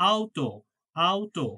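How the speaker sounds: auto said as "ow-to"; German auto with an ow sound; German au diphthong. Shown as a demonstration. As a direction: say auto opening wide on "ow". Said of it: "আ উ একসাথে থাকলে আও".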